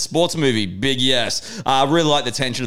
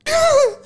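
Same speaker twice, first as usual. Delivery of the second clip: squeaky high laugh-sob; 4 dB louder than usual. Retold or imitated. Retelling his breakdown in the car, high laugh-sob.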